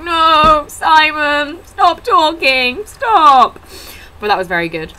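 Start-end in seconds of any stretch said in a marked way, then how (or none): 0.0-3.6 higher, slow pleading; silent cringe plea. Retold or imitated. Two fans begging the comedian to stop, cringing. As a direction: beg him to stop, cringing. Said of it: "Me and Katrina were both like".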